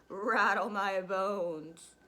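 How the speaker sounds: very slow, loud spooky voice; loud, drawn-out haunting parrot. Acted. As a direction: drag the words out like a ghost. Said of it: "giving a wonderful imitation of a spooky voice".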